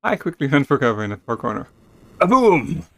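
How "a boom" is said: loud boom announcement; loud dramatic boom; excited. Acted. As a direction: make the blast big. Narrating explosion.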